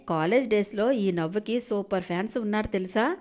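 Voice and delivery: usual speaking voice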